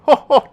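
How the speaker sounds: high, loud "ho, ho" chuckle; jokey laugh. Shown as a demonstration. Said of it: "sometimes they make me laugh".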